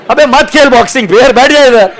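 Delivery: high, loud comic scolding; coach's angry shout. Acted. Coach telling a complaining boxer to sit down, scolding shout. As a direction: yell at a whining player to quit.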